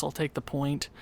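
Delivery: normal talking voice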